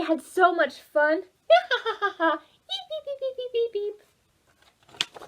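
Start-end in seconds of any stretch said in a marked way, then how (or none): 2.2-5.2 high beeps through laughter; laughing beep noises. Acted. Storyteller making playful beeps, laughing. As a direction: giggle out the beeps.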